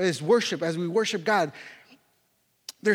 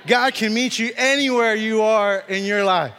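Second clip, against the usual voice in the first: slow, louder proclamation; emphatic preaching; 5 dB louder than usual. Real, not acted. Preacher assuring the congregation God reaches them anywhere, emphatic. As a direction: drive it home, stretching each word with force. Repeats refrain with more force.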